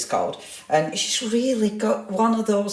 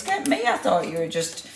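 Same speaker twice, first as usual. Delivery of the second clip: ordinary talk, nothing marked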